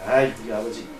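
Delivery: low, soft inward grumble; retold irritation at his father, kept under his breath; annoyed grumble. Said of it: "속으로는 짜증이 났어요".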